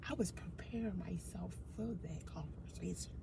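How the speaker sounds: soft whispered aside. Real, not acted. Telling friends about prepping for a talk, in a whisper. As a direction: breathe it like a secret.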